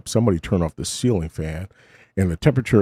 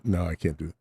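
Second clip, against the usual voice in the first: ordinary delivery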